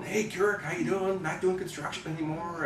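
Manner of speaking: put-on high, bright hello to an old acquaintance; cheery phone greeting. Director greeting the actor on the phone, high and cheery. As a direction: greet him like a long-lost friend.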